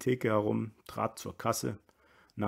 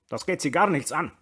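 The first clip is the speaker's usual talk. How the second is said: higher, louder, rasped snub; rasping rebuff; 6 semitones higher, 8 dB louder than usual. A narrator's reading. Brüller rebuffs the one-armed soldier, rasping. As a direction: rasp it out to cut off the question. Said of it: "schnarrte der Brüller".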